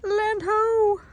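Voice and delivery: high loud call. Real, not acted. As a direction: sing out like a lookout. Calling out on arrival.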